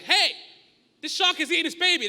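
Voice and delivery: loud, high careless call; bored lifeguard. Retold or imitated. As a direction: lazily call out a shark alarm.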